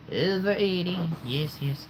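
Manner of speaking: high, hammed-up echo; put-on funny voice. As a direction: repeat it in a silly high voice. Repeats own brag in put-on voice.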